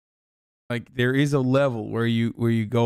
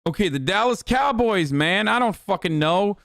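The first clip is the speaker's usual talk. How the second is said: high-pitched, exasperated brush-off; 8 semitones higher than usual. Real, not acted. Streamer teased over a sports slip, exasperated. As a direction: throw up a defensive, high-pitched protest.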